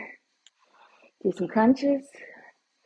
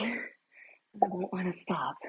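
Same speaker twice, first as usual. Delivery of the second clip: whispering; 9 dB quieter, much slower than usual. Real, not acted. Aside about loving results.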